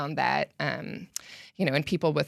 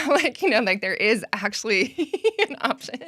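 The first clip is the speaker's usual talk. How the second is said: talking through laughter, higher and louder; laughing; 7 semitones higher, 6 dB louder than usual. Real, not acted. Pointing out an obvious option to soccer parents, laughing. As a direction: let real amused laughter break up the words.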